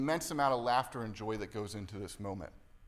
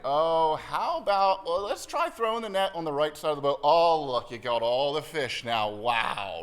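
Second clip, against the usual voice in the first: playful teasing; 7 dB louder than usual. Shown as a demonstration. Jesus playfully teasing the disciples, loud mock surprise. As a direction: play it big and playful, like a brother joking. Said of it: "in a half-teasing manner".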